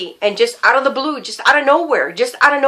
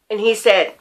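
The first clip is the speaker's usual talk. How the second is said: normal talking voice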